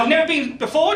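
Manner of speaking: usual speaking voice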